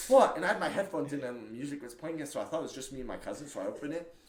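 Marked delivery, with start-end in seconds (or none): 0.1-0.4 loud, high burst of surprise; shocked what. Retold or imitated. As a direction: blurt it out, stunned. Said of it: "I was like".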